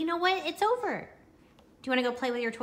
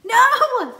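loud high "No!"; sharp shout; 14 semitones higher, 18 dB louder than usual. Real, not acted. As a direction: shout to stop him. Toddler spills, mom yells.

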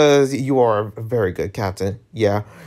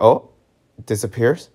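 ordinary delivery

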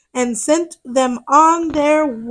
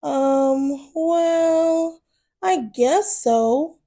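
hesitant; 6 dB quieter, much slower than usual. Acted. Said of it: "still feeling a little nervous".

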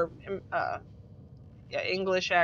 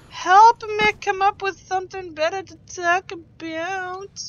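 mock plea; 12 semitones higher, 6 dB louder than usual. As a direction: wail it playfully at a much higher pitch.

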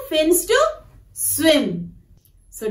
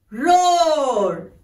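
long drawn-out roar, rising then falling; teacher's mild lion roar; lion sound; 2 semitones lower than usual. Shown as a demonstration. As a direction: stretch the word like a gentle lion's roar. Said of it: "the sounds of animals".

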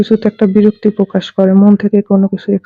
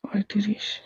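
very soft, barely projected; too quiet; 14 dB quieter than usual. Real, not acted. Healer asks the possessed patient's age, very quiet answer. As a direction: answer well under normal volume. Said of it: "জোরে কথা".

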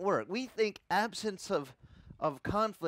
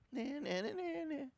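much higher, soft mock kid voices; scared kids backing off; 10 semitones higher, 6 dB quieter than usual. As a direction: squeak out the retreat like frightened boys. Bullies back down from uncle.

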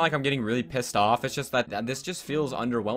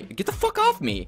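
much higher, a frantic squeal; panicked shriek. Real, not acted. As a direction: shriek it as an enemy jumps you. Repeats it, far higher.

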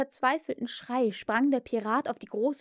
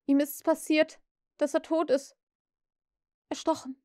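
higher, hollow and halting; 5 semitones higher than usual. A narrator's reading. Shocked pirate reports a death to his captain, hollow-voiced. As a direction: report it numbly, fading on the last word. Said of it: "mit hohler Stimme".

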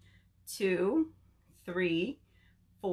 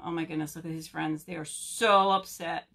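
usual speaking voice

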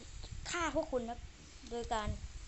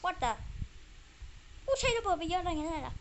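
surprised exclaim; 6 semitones higher than usual. Real, not acted. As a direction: blurt it out in disbelief. Gamer exclaims over used bombs.

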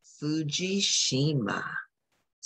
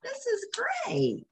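delighted aside; 5 dB quieter than usual. Real, not acted. Reader delighted.

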